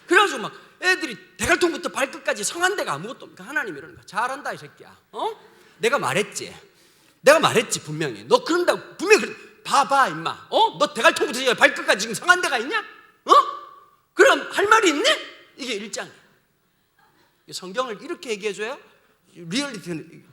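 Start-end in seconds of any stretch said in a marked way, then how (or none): 4.1-15.1 scolding God. Acted. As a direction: berate a child who ignored you. God scolding Israel like parent.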